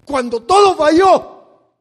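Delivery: loud, slow, emphatic line; fiery preaching. Real, not acted. Preacher rebuking the congregation, loud and slow. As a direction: hammer the point home. Climax of the sermon.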